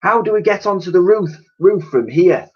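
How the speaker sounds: put-on urgent question from the girl; girl asking urgently how to reach the roof. A narrator's reading. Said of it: "asked the girl".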